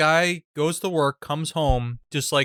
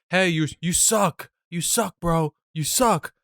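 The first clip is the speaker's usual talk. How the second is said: drawn-out, higher-pitched heckle; mock taunting; 3 semitones higher, slower than usual. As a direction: imitate a troll calling someone out. Imitates friend taunting gamer online.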